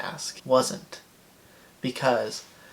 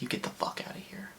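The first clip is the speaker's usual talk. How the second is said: whispered; 11 dB quieter, faster than usual. Retold or imitated. Telling off the odd stranger.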